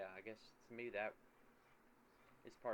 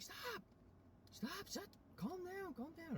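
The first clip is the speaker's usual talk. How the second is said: squeaky retold scolding, slightly softened; imitated exasperated partner; 5 dB quieter, slower than usual. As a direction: mimic someone desperate to halt the panic. Acting out partner trying to stop panic.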